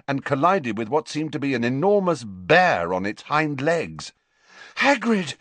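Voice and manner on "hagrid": panting. Acted. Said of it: "he panted".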